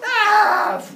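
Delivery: loud high ah; high loud falling yell; falling scream. Retold or imitated. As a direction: scream as you fall. Cyclops falling.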